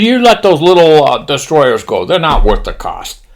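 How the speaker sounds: lightly voiced Japanese admiral, starting high. Admiral briefing pilots, light mock-Japanese voice falling away. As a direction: begin high and loud, then ease down. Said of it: "He says".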